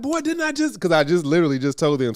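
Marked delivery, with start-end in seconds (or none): 0.0-0.8 much higher, incredulous scolding; exasperated dad. Retold or imitated. Dad scolding his son after the ball hit, high exasperated voice. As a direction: throw up your hands at the kid. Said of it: "I'm like".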